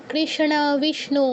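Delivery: slow কৃষ্ণ, বিষ্ণু as krish-na, vish-nu; sh-n conjunct. Shown as a demonstration. Teacher repeats for students, slow sh-n in কৃষ্ণ. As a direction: draw out sh then n in কৃষ্ণ, বিষ্ণু. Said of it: "এরকম".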